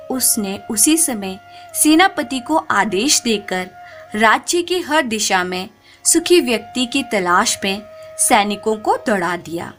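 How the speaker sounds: usual speaking voice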